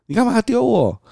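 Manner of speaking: put-on high squeaky toddler protest; mock whine. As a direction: whine it like an offended little kid. Father voices toddler's protest. (高声模仿小孩委屈抗议)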